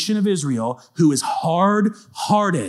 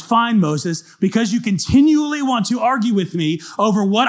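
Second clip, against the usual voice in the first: higher and louder, God pleading fervently with Moses; exasperated God; 7 semitones higher, 4 dB louder than usual. Retold or imitated. God to stubborn Moses, exasperated pleading. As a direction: plead like a fed-up loving father.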